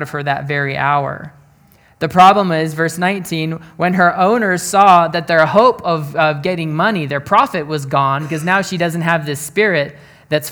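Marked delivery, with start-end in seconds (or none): none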